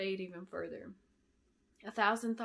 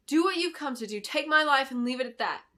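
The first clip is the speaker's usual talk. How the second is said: raised, defiant plea; defiant heroine; 5 semitones higher, 8 dB louder than usual. A narrator's reading. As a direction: stand firm and offer yourself. Belinda defiant, louder.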